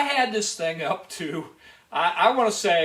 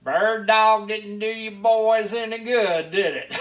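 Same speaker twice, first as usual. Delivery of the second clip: slow Southern state trooper drawl, louder and higher; 4 semitones higher, 4 dB louder, slower than usual. Retold or imitated. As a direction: drawl it like a teasing Georgia trooper. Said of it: "he had that southern drawl".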